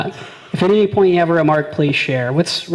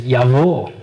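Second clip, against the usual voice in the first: mock Russian; 3 semitones lower than usual. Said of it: "I spoke Russian".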